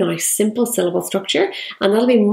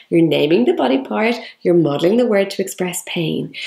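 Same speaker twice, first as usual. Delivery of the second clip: ordinary talk, nothing marked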